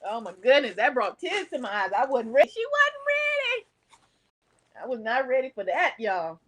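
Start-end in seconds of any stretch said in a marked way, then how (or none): 2.5-3.7 very high, drawn-out squeal; high-pitched plaintive cry; overcome squeal. Real, not acted. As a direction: let it shoot up high and slow. Overcome by memory of her dog.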